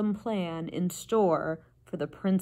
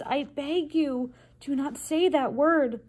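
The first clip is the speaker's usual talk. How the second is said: higher-pitched, urgent plea; pleading; 7 semitones higher, slower than usual. A narrator's reading. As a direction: beg with drawn-out emphasis. Louise pleads with upset cook.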